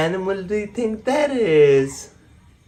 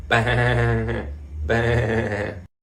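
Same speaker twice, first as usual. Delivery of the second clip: lightly voiced sheep bleat; 5 semitones lower than usual. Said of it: "The sheep says".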